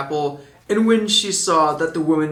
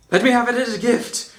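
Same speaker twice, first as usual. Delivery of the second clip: higher, quick pleading prince; lovesick prince; 7 semitones higher, faster than usual. A narrator's reading. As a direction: beg like a smitten prince. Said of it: "perfect voice for that".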